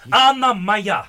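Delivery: high, loud claim; possessive cry. Acted. Soldier declaring that Anna is his, high and loud. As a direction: call out that she is his.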